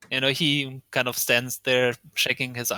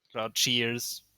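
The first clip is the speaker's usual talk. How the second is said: usual speaking voice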